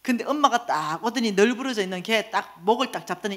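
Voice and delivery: normal talking voice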